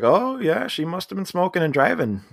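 high-pitched lazy cop impression; small-town cop. Podcaster mimicking cops leaning on their car, offhand small-town cop. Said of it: "stereotypical small town cops who are just".

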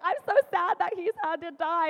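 loud, high-pitched pretend upset; mock crying. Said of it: "she's, like, crying".

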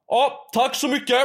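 high, loud mocking thank-you; mock cashier thanks. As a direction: ape her curt dismissal. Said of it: "bara reser hon handen så här bara".

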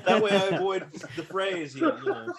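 loud laughing, voice up high; cracking up at the own joke. Real, not acted. To the hosts, laughing loudly at the N-word joke.

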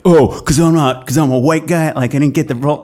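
mock angry. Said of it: "it makes them more angry".

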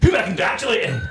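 a bar entrance, full of swagger. Retold or imitated. Raymond bursting into the bar, booming and hearty. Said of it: "larger than life, uh, character".

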